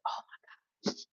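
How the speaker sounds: breathy whispered oh my gosh; whispered awe. Pretend. A reader mocking a grand reaction, whispering in awe. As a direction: breathe it out in hushed wonder. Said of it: "something so huge that's like".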